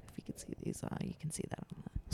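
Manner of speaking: soft unvoiced aside; whispering. Real, not acted. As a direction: drop to a whisper while showing it. Aside while showing an object.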